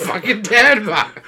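loud, high, stretched out through laughter; real laughing exclamation; laughing outburst. Real, not acted. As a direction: shout it while cracking up. Cracking up at own bit.

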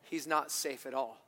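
soft, low and hushed; a hint of the solemn beaver, quiet. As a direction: lean in and say it gently. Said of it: "And the beaver says".

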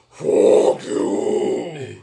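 loud, drawn-out demonic roar; possessed demon. Retold or imitated. Evicted woman cursing the manager, demon-possessed voice. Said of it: "she was possessed by a demon".

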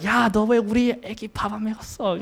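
high, fast mock scolding in Korean; scolding Korean grandma. Grandma yelling at the parents for not feeding the baby, fast high scolding. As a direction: rattle it off like a furious grandma. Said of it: "she yells at our mom and dad".

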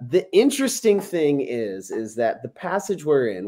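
ordinary talk, nothing marked

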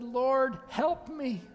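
high-pitched cry for help; pleading. Acted. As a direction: beg aloud as a desperate supplicant. Voices the woman begging Jesus.

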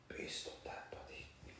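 whispered under the breath; hushed, breathy disbelief; stunned whisper. Retold or imitated. Storyteller reacting to the creepy date on screen, stunned whisper.

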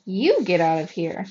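loud retort leaning hard on "You"; angry yell back. A narrator's reading. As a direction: throw it back at the heckler, punching "You". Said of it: "He whirled around and yelled".

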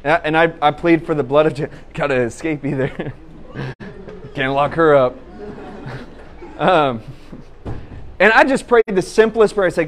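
ordinary delivery

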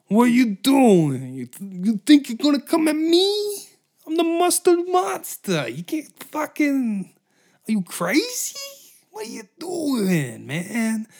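high, loud squeaky creature voice, slowed; shrill cartoon monster squaring up; mustard monster. Mustard monster threatening the dudes in a high, loud squeaky voice. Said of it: "the mustard monster's like".

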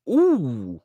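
high, loud delighted ooh; mock-swooning ooh of delight; savoring ooh. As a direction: swoon over the sound. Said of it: "And it's just like".

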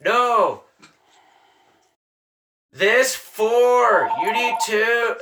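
high, loud, word-by-word instruction; acted emphatic correction. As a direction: imitate a conductor setting a tourist straight. Acts out conductor correcting him.

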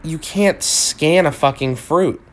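ordinary delivery

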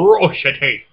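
ferocity loud with a rolled-out "ROH"; exaggerated mispronunciation. Defying the co-host's correction, ferocity loudly overdone with "oh". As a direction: lean hard on the long "oh" in ferocity. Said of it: "You said ferocity".

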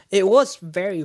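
a loud, dramatic setup before the hush; emphatic very. Acted. Dramatic build to a hushed reveal.

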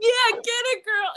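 high, loud playful whoop; egging-on cheer. Retold or imitated. Photographer hyping a couple, loud high cheer. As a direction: cheer her on at the top of your range.

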